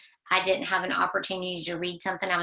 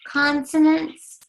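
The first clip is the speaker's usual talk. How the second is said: high, loud and slow consonants; teacher models the new word for the class; 9 semitones higher, 8 dB louder, slower than usual. Shown as a demonstration. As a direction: sound out consonants big for kids to copy. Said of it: "Say it".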